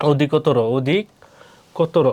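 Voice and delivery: অধিকতর whole, then very slowly as "odhik... kotoro"; teacher breaking down অধিকতর with a rounded final vowel; sounded-out demo. Shown as a demonstration. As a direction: split অধিকতর with a long pause, ending in o. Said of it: "অ এর উচ্চারণ উকার হবে".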